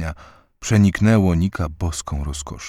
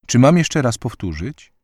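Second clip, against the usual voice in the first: politely offering, lifted pitch; courteous, higher-voiced query; polite question; 6 semitones higher, 4 dB louder than usual. A narrator's reading. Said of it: "zapytał uprzejmie".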